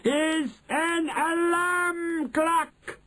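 very high, hugely drawn-out reveal; triumphant announcement. A narrator's reading. Grand High Witch revealing her secret, triumphant. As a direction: stretch every word at sky-high pitch. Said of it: "announced the Grand High Witch triumphantly".

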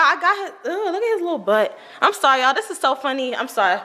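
normal talking voice

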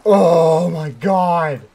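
loud drawn-out exclamation; stretched, startled yell; shocked outburst. Real, not acted. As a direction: cry it out long and loud. Shocked cry at a game scare.

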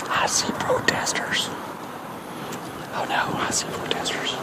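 hushed unvoiced whisper. Real, not acted. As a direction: whisper so nobody hears. Sneaking past protesters.

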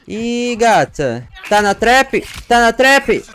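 high loud sing-song mockery; mocking taunt. Real, not acted. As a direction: gloat over the trapped victim. Mocking trapped survivor.

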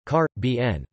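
"car, B N" with the stress on car; stress on first syllable. Shown as a demonstration. Reader shows the Caribbean English pronunciation, "car, B N" stressed on car. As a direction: lead with a stressed car in "car, B N", then fall away. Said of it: "is in fact on the first syllable".

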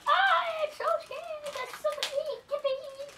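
high, slow mock fright; pretend scared. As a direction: play at being frightened. Child playing with toys.

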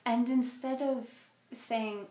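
ordinary delivery

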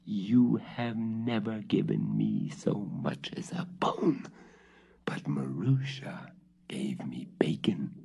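a hissed, breathy grievance; hissed whisper. Acted. The cat hisses its grievance at the witch. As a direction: hiss it back at your mistress. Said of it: "hissed the cat".

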